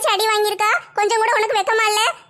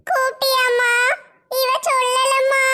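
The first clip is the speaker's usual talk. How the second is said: put-on drawn-out weepy child voice; acted crying, words stretched out; tearful; 3 semitones higher, slower than usual. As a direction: whimper to mother, holding back sobs. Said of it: "மஞ்சு அழுதுகிட்டா இருக்க".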